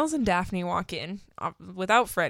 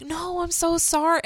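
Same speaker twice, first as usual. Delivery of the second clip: mock apology; 10 semitones higher than usual. [name] apologising.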